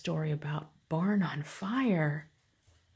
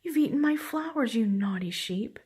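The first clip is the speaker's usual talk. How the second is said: high, louder scolding that drops at the end; angry shout; 5 semitones higher, 5 dB louder than usual. A narrator's reading. Farmer to the naughty sheep that ate her flowers, angry shout. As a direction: scold like a cross storybook farmer. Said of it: "she shouts".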